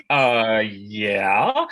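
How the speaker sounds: drawn-out, unsure yes, rising like a question; hesitant yes. Retold or imitated. Actor answering an odd accent request, hesitant yes. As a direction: agree doubtfully, stretching it out.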